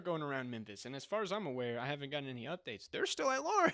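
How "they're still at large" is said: much higher and louder burst of outrage; sudden high outraged cry; outraged exclaim. Real, not acted. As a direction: blurt it out, high and loud. Outraged about an unsolved shooting case.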